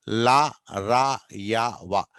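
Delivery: లా, రా, యా, వా slowly, each stretched; chant-like list giving లా and రా long vowels; separate, drawn-out model of లా, రా, యా, వా; approximant demonstration. Shown as a demonstration. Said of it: "ఇది ఎలా పలుకుతామంటే".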